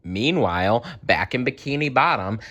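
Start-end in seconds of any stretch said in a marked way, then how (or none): none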